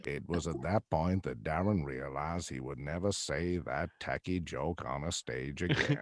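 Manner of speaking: deadpan deep narrator commenting on a blunder; mock narrator. Narrator telling the audience Darren regrets his joke, mock narration.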